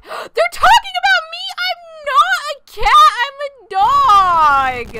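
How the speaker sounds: high, loud indignant shout; mock outrage. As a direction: protest loudly, half joking. Misnamed as cat, outraged.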